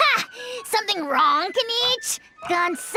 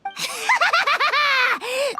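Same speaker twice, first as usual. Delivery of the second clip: long, rapid gloating cackle; gleeful villain cackle. Acted. Dragon lord finally let loose on a liar, gleeful cackle. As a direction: cackle with mischievous relish. Creature given leave to attack.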